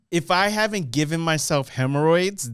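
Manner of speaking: ordinary delivery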